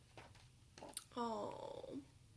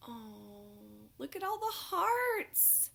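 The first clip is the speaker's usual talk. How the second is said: higher, delighted look; delighted gasp; 4 semitones higher, slower than usual. Acted. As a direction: coo over the hearts.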